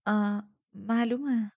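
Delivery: slow, hesitant agreement; stammering. A narrator's reading. Girl agrees to talk, caught off guard, stammering. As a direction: falter on a drawn-out first sound, then agree. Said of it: "به لکنت افتادم".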